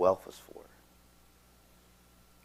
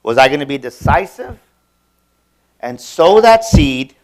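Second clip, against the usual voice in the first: preaching climax; 4 semitones higher, 14 dB louder than usual. Real, not acted. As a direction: drive it home to the congregation. Sermon climax, decision.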